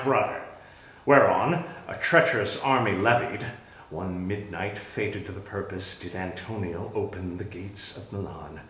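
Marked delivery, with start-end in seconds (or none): 3.9-8.6 softer, low and dark; muted, sombre recollection; hushed storytelling. Acted. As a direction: sink into the grim memory. Hushed telling of midnight betrayal.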